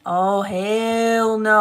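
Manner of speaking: slow, drawn-out indignant refusal; outraged refusal. Retold or imitated. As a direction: stretch each word in mock outrage. Outraged mother retelling her reaction.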